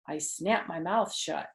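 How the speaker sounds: put-on lively read-aloud voice for kids; animated children's book reading; story narration. A narrator's reading. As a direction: read it like storytime, voicing the characters. Reads Junie B. story aloud.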